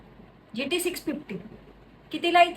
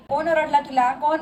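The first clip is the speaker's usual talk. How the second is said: ordinary talk, nothing marked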